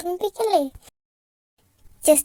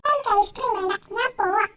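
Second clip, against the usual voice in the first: put-on higher little boy refusing; sulky child; 7 semitones higher than usual. Son refusing his father's ice cream, higher sulky child voice. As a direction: pout it out like a jealous kid.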